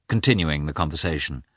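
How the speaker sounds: ordinary delivery, nothing marked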